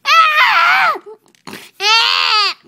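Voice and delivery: high, loud wailing scream; shrieking wail; upset screams. Acted. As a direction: cry out in short piercing bursts. Upset Jimmy keeps screaming.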